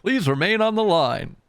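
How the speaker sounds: high, loud, swooping mock announcer; hold-message operator.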